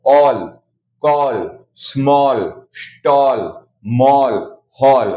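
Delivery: ऑल, कॉल, स्मॉल, हॉल said with a long "aw" vowel; each word said separately and slowly; aw sound demo. Shown as a demonstration. A teacher repeating the "aw" words for students. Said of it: "अ से किया है".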